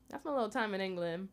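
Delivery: usual speaking voice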